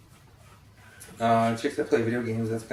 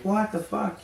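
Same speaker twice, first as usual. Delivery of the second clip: shocked disbelief; 9 semitones higher, slower than usual. Retold or imitated. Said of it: "and you think".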